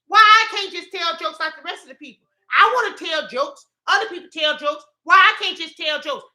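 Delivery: high, loud comic protest; exasperated mock pleading; whining complaint. Speaker asking the Lord why others tell jokes, mock whine. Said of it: "that's why I be telling the Lord".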